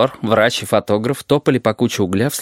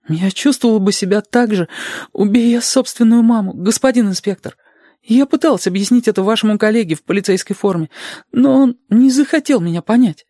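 put-on very high girlish voice; high-pitched girl pleading to be understood; earnest young girl; 11 semitones higher than usual. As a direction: explain yourself earnestly, pitched high throughout.

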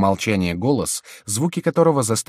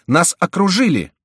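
high, loud alarm; alarmed cry; 10 semitones higher, 7 dB louder than usual. A narrator's reading. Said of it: "вскричал прибежавший монах". (тревожный крик)

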